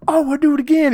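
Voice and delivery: a hammed-up butler cranking it up; squealing mock butler. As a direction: squeal it like a giddy show-off. Butler escalates the coin game.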